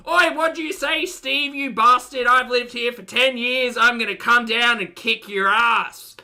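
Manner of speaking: high, full-volume furious shouting; acted enraged bellowing; really mad. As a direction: threaten the neighbour at a yell. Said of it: "gets really mad and says".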